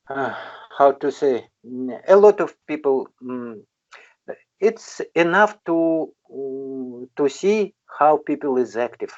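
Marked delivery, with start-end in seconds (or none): none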